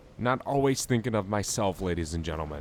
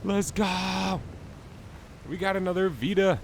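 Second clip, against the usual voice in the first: high, thrilled cheer; excited cheer; 11 semitones higher than usual. Real, not acted. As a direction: celebrate the lucky loot. Cheering a second rare find.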